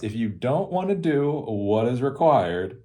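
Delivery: ordinary delivery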